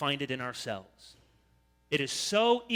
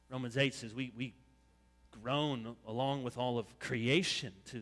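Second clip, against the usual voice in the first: normal talking voice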